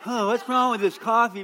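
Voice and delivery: ordinary talk, nothing marked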